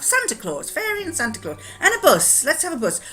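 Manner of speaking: high, bouncy list of story ideas; eager suggestions. Retold or imitated. Grandchildren pick things for a made-up story, eager suggestions. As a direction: toss out each idea in a lively high voice.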